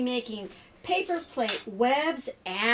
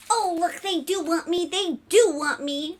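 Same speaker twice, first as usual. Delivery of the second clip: high, loud, thrilled spider voice; overjoyed little spider; 8 semitones higher, 6 dB louder than usual. Acted. Spider realizing the family wants it, overjoyed. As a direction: rejoice as a storybook spider.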